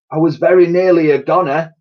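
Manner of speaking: lightly voiced old soldier telling his tale; Titch's voice. A narrator's reading. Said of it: "he said".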